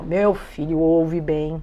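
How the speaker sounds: pai seguro de si, lento e mais grave; convicção encenada. Acted. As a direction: garanta devagar, em tom mais baixo. Pai encenado negando problema de audição. (com convicção, devagar e grave)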